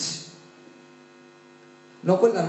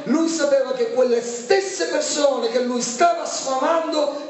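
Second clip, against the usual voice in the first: higher, impassioned preacher's climb; fervent preaching; 7 semitones higher than usual. Acted. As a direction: build the sermon to its peak. Preaching builds to climax. (predica appassionata, voce più alta)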